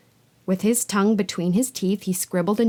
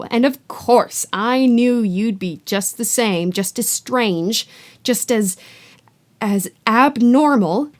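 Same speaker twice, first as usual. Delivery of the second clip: loud, bitter ranting aunt; 4 semitones higher, 5 dB louder than usual. Acted. Aunt ranting to Harry about her witch sister, loud. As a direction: rant like years of resentment bursting out. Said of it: "went ranting on".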